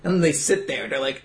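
usual speaking voice

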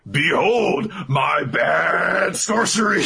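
high, slow showman's shout; grand reveal with stretched-out words; theatrical Chrome. Acted. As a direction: proclaim it like a hammy wizard. Chrome presenting his sorcery.

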